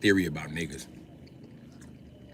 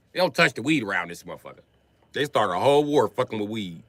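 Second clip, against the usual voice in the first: usual speaking voice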